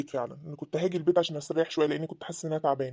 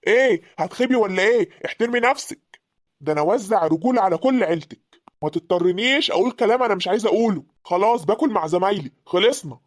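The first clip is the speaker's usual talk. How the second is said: loud, forceful rebuke; angry shouting back; 6 dB louder than usual. Acted. Husband tells wife to respect herself, angry shouting. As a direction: warn her off at raised volume.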